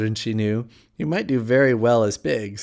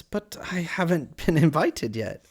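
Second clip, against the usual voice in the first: higher character voice; polite Alice; 7 semitones higher than usual. A narrator's reading. Alice answering the Cat's invitation, higher and polite. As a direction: pitch it up, politely.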